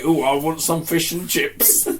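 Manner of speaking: slow, high caricature of the English; hammed-up English accent with a laugh; mock English accent. Co-host mocks how the English talk, exaggerated English accent. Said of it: "the way that they say".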